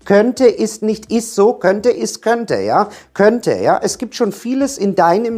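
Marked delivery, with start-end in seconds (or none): 0.0-2.6 every "könnte" high, slow and weighted; slow right down and lift each "könnte"; stress on KÖNNTE. Shown as a demonstration. Coach insisting on the exact word to viewers, emphatic KÖNNTE. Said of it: "Fett unterstrichen". 3.1-3.6 "Könnte" punched on a raised pitch; stress on KÖNNTE. Shown as a demonstration. Coach checking viewers have got it, stressed KÖNNTE. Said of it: "Die Betonung liegt im".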